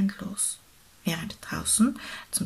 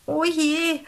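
beaver's worried exclamation, pitched up; drawn-out, higher storybook exclamation; calling out; 8 semitones higher, 4 dB louder than usual. A narrator's reading. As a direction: cry out in mild dismay. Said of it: "rief der Biber".

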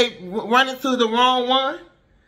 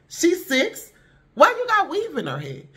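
incredulous; 5 semitones higher than usual. Retold or imitated. Reacting to made-up little girls.

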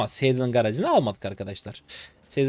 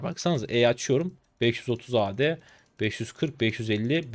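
ordinary talk, nothing marked

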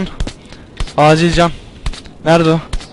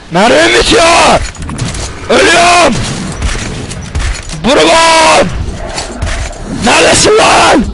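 panicked yelling; 10 semitones higher, slower than usual. Real, not acted. Gamer dying and out of ammo, calling teammate with panicked shouts. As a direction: scream for your teammate as you die.

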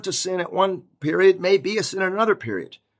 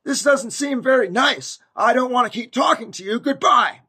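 high, rising acted outburst; shouting out; 9 semitones higher, 5 dB louder than usual. Fed-up person finally hanging up on a condemning caller, shouting out. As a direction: stand up to them, building to a loud goodbye. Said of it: "you just shout out".

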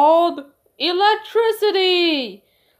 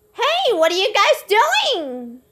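put-on child voice; 7 semitones higher, slower than usual. New child arrives.

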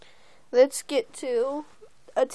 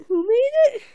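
high, loud mock-curious question; excited teasing question; 9 semitones higher, 6 dB louder, faster than usual. Creator asks viewers who made the story, excited and teasing. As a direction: ask it with big playful curiosity.

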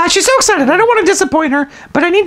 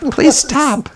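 usual speaking voice

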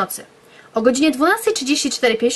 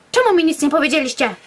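indignant question; 6 semitones higher than usual. Acted. Narrator confronts friends.

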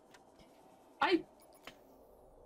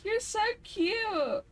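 sweet high coo to a little creature; 8 semitones higher, slower than usual. Real, not acted. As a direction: coo at it like a puppy. Cooing at a creature.

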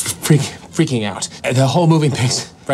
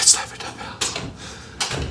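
whisper; 8 dB quieter, much slower than usual. Retold or imitated. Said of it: "He said".

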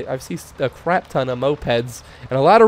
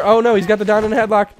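ordinary talk, nothing marked

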